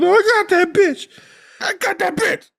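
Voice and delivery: high, fast sing-song taunt; mock Emily. Mocking Emily's cheery lack of fucks in a high chirp. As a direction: chirp it like a carefree brat.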